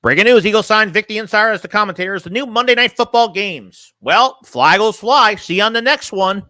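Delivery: high, sing-song breaking-news parody; mocking impression. Streamer making fun of somebody with fake breaking news, mocking announcer impression. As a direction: announce it in a put-on swooping host voice. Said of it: "we're just making fun of somebody".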